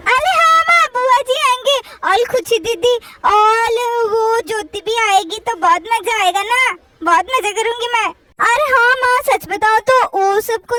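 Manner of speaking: put-on lisping child, delighted about guests; eager little-kid voice; little girl Chutki, eager. Chutki looks forward to guests.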